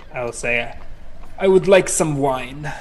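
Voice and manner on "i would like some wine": put-on slow, haughty fantasy accent; lofty elf putting on airs; pretentious Elven accent. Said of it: "in pretentious Elven".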